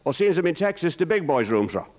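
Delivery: high, polite put-on voice; Murphy asking politely. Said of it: "He says".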